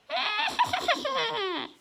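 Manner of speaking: high-pitched witch laugh; witch cackle. Shown as a demonstration. Teacher impersonating a witch's laugh to help students, high cackle. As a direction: let out a screechy witch's cackle. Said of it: "I'll do a little impersonation".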